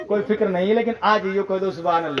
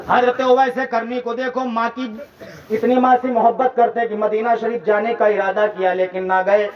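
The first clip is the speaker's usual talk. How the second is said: ordinary delivery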